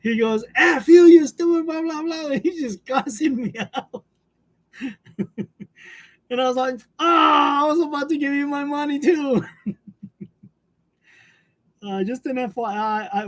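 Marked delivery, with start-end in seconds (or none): 0.6-2.3 loud, high mock tirade; imitated angry outburst. Scammer cussing out the streamer, imitated angry outburst. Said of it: "that dude just went off on me". 7.0-9.4 high, loud retort played for laughs; mock outraged yell. Streamer answering the scammer who cussed them out, mock outraged yell.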